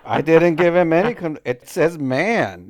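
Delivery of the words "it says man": MAN drawn out with a flat "a" as in cat; insistent, long-held MAN; stress on MAN. Real, not acted. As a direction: stretch MAN slowly to correct the reading. Host corrects misread word.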